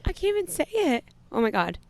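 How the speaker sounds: trying to speak through real tears; crying. Real, not acted. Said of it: "she's really crying".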